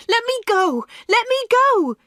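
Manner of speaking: high, loud, rising panic; panicked cry. Acted. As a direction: struggle free, desperate and shrill. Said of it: "she cried".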